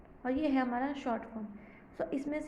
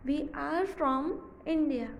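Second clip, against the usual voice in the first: very slow, word by word; slow revision reading; slow rereading; 2 semitones higher, much slower than usual. Shown as a demonstration. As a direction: reread slowly with the class. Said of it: "फिर है".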